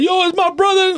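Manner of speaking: high mock gushing "Yo, it's my brother"; a hammed-up fake friend, voiced high; mocking fake buddy. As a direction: parody someone claiming you as family. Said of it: "a lot of people be like".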